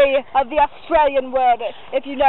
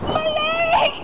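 mock pained cry; 11 semitones higher than usual. Bitten partner cries out.

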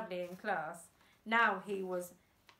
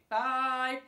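loud count; 3 semitones higher, 8 dB louder than usual. A narrator's reading.

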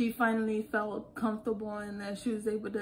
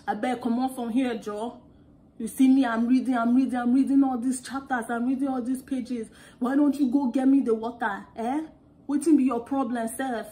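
loud, indignant Nigerian Pidgin rant; African side; 7 dB louder than usual. Retold or imitated. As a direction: scold in a heavy African accent. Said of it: "my African side came out".

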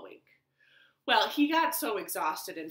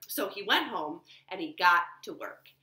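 plain reading voice

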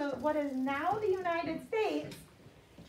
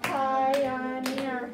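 Pioneer split into separate, louder syllables; clapped syllables; 2 semitones lower, 5 dB louder than usual. Shown as a demonstration. Teacher clapping Pioneer with the class, syllable by syllable. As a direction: clap out each syllable of Pioneer. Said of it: "Let's clap the syllables in pioneer".

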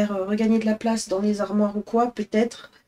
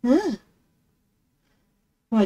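ordinary talk

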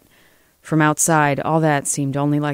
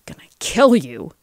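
wounded threat, moderately raised; strained yell; 3 semitones higher, 3 dB louder than usual. A narrator's reading. Shot Frank staggers towards Lena, yelling with strain. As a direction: force out a threat through pain. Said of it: "yelled Frank".

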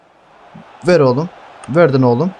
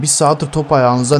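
ordinary talk, nothing marked

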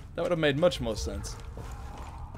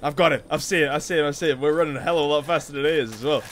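ordinary delivery

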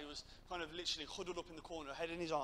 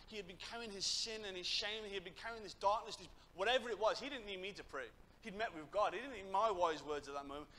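raised, emphatic sermon voice; impassioned preaching; 4 semitones higher, 5 dB louder than usual. Acted. Preacher tells youth how a lad met God, impassioned. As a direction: drive the testimony home with fervour.

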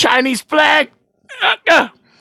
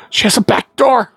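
ordinary talk

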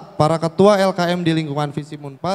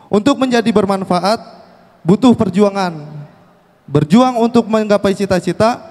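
ordinary talk, nothing marked

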